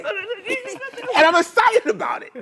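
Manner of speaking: very high giggle, then loud excited line; bubbly. Shown as a demonstration. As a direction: squeal with giggling delight. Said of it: "this is bubbly".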